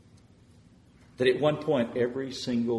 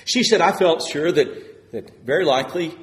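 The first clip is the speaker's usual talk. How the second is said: loud, high preaching burst; preaching surge; 7 semitones higher, 14 dB louder than usual. Acted. Preacher retelling a patient's fear, loud and high. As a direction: throw the words out to the congregation.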